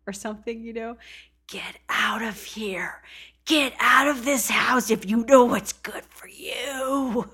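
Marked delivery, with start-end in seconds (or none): 1.5-7.3 slow, menacing stage whisper, forceful and drawn out; scary movie whisper. Retold or imitated. Imitating a caller's spooky whisper as a horror-movie warning, menacing forced whisper. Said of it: "His whispering ... reminded me of a scary movie".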